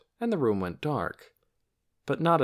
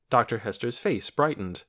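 ordinary delivery

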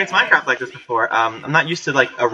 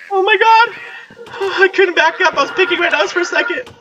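high, squealed, half-laughing explanation; shaken after scare. Real, not acted. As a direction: squeal it out, still reeling from the scare.

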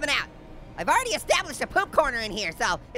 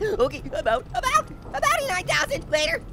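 high panicked babble; 11 semitones higher than usual. Real, not acted. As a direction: bolt away in a panic. Fleeing in fright, high.

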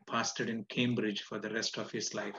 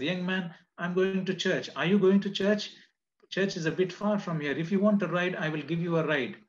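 a hint of a kind lady, higher; lady inviting a stranger to church, mildly higher; lightly voiced woman; 7 semitones higher, 6 dB louder than usual. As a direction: offer the ride sweetly, pitched up. Rich woman voiced in story.

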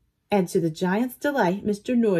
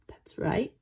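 whispering; 7 dB quieter than usual. Acted. Storyteller whispers reveal.

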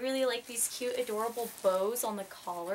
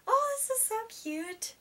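very high excited squeal; high-pitched squeal of delight; 11 semitones higher than usual. Real, not acted. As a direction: burst out with delight. Squealing at new socks.

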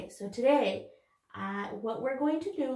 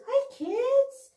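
much higher cheerful puppet greeting; 11 semitones higher, 4 dB louder than usual. Pretend. Puppet dog greeting the kids in a high voice. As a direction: say hello as the little dog puppet.

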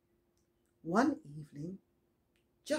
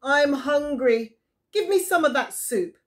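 demanding Esau; 7 semitones higher, 9 dB louder than usual. Acted. Hungry Esau demanding soup from Jacob, loud. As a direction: call out for soup like a hungry hunter. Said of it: "said Esau".

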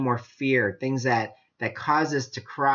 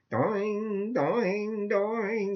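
mock boing; 5 semitones higher, 5 dB quieter, much slower than usual. Imitating a man leaping far off.

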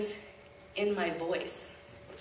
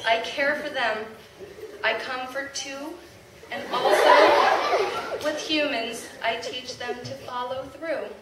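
proud, louder poem reading with laughs; poem recital; 2 semitones higher, 6 dB louder than usual. Acted. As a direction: recite it to the crowd with pride. Reads a poem aloud.

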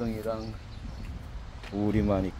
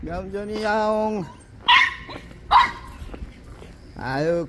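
cooing pet talk; 8 semitones higher, 8 dB louder than usual. Real, not acted. Fussing over a picky stray cat, high cooing baby talk.

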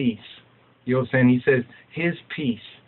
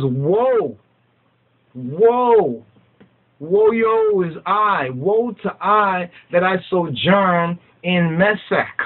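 slow, much higher wailing woe; lamenting scripture; 5 semitones higher, slower than usual. Acted. Preacher intones the psalm's woe to the congregation, slow and wailing.

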